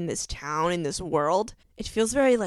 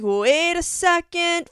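slow, drawn-out and much higher, loud realization; excited gasp; 9 semitones higher, 6 dB louder, slower than usual. Retold or imitated. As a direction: stop short as it hits you. Gushing reaction quoted to herself.

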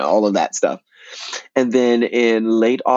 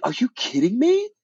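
high, incredulous squeak; mock disbelief. [name] retelling his shock at Bart's email, high-pitched disbelief. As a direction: act stunned at the offer. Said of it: "And I was like".

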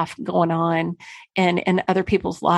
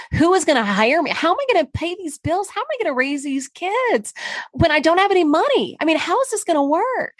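much higher, rising panic; mother lying awake at night, worrying about money and kids, in a much higher panicked voice; 9 semitones higher than usual. Retold or imitated. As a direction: let her fear climb high as the questions pile up. Panicked divorcing woman's inner voice.

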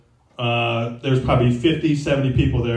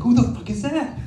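incredulous; 10 semitones higher than usual. Retold or imitated. Asks about the kid, amazed.

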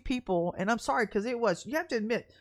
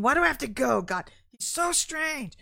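mock whine; 4 semitones higher, 4 dB louder than usual. Whining to God in prayer.

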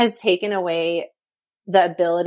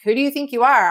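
pissed off; 7 semitones higher, 4 dB louder than usual. Retold or imitated. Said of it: "at first I was pissed".

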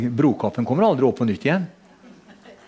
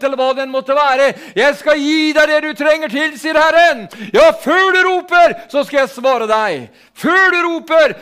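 high, loud, ringing prophetic cry; preaching at full force; 12 semitones higher, 4 dB louder than usual. Acted. Preacher crying out God's promise to the church, full-force prophetic shouting.

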